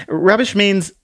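high, polite little lady; curtsy it out sweetly, voice up high; prim little book voice. Acted. Book introduces herself to the man with a high dainty bow.

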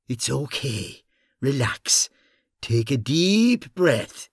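slow, soothing reassurance; calming voice. Acted. Bunny soothing Paddy.

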